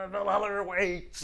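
lightly voiced Viking; a hint of a Viking battle cry. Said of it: "I need to work on my Viking voices".

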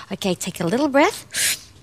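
ordinary talk

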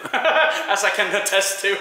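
chuckling through a proud joke; slowing down into a laugh. Real, not acted. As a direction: laugh it out, amused at yourself. Jokes about being a Viking.

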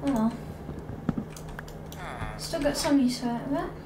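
usual speaking voice

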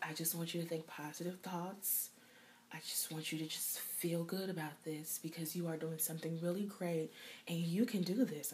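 soft, hushed, soothing nurse voice; calm her down gently, very softly; hushed nurse soothing a patient. Retold or imitated. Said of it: "she's like".

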